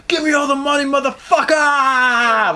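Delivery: milk it like a movie stickup; Pulp Fiction robber. Retold or imitated. Robber storming the store like Pulp Fiction, hammed-up. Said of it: "Like in fucking, like, Pulp Fiction".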